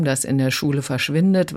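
ordinary talk

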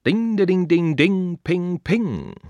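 high, sung piano notes; sung piano imitation. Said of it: "Klavierkonzert Nummer drei". (hohe, gesungene Klavier-Nachahmung)

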